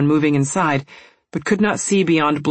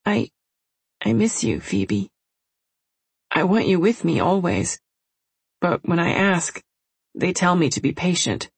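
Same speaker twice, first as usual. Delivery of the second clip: put-on sleepy young girl; 3 semitones higher than usual. Sleepy little sister.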